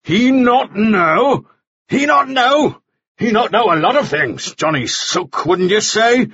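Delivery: high, drawn-out mocking outburst; exploding with annoyance. A narrator's reading. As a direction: throw the words back in exasperation. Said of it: "Mannering exploded with annoyance".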